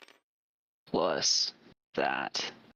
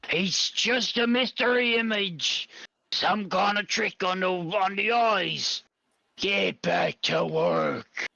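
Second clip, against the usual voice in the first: higher, slow, scornful brush-off; dismissive illusion voice; 5 semitones higher, slower than usual. Acted. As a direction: wave them off like a bored guard. GM voices Vincenzo image dismissively.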